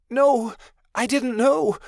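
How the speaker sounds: high, slow, pleading no; desperate denial. A narrator's reading. Midnight Star denies to dying friends, pleading. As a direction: beg them to believe you.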